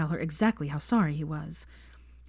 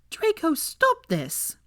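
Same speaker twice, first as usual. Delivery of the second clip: much higher, slow, begging plea; pleading; 11 semitones higher, much slower than usual. A narrator's reading. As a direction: beg him to stop. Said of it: "Pansy pleaded".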